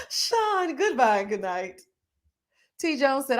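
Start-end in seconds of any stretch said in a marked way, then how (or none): 0.1-1.8 laughing. Real, not acted. Answering Sean's joke while still laughing, laughing. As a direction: laugh his name out.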